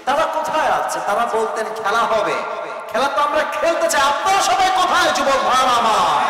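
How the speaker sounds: high, loud rallying shout; full-force preaching. Real, not acted. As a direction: fire up the crowd at full voice.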